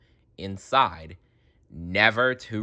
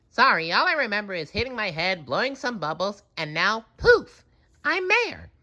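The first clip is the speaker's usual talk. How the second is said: high, quick chatter; breezy explanation; SpongeBob voice; 12 semitones higher than usual. A narrator's reading.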